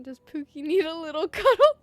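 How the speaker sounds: put-on loud, teasing baby-talk; exaggerated babying, swelling loud; mock coddling. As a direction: coo mockingly as if at a pouting pet. Mock comforting a game character.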